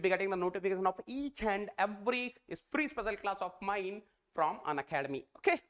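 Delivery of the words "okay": usual speaking voice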